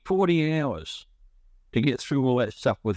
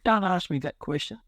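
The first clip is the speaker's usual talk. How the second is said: higher-pitched, mildly choked-up protest; retold tearful plea; 6 semitones higher than usual. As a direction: plead as if retelling a tearful moment. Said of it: "and I'm crying".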